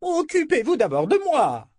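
shrill, high whining cry; whiny voice. Acted. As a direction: whine it like a spoiled noblewoman. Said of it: "lança une voix géniarde".

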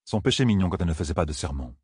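usual speaking voice

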